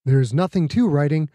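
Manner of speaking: ordinary delivery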